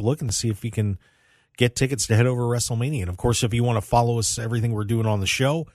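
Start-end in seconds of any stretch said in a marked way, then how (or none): none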